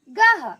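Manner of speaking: गह as short, loud "gaha"; गह ending on a half ह; visarga breath. Shown as a demonstration. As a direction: punch गह with an h release. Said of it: "कैसे उच्चारण करेंगे".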